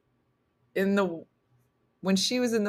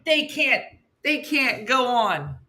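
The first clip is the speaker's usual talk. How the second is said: exasperated; 6 semitones higher, slower than usual. Real, not acted. Exasperated mock lament about kids.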